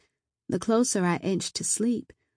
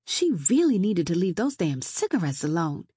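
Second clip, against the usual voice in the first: ordinary delivery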